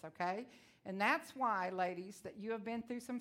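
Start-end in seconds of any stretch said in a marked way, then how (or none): none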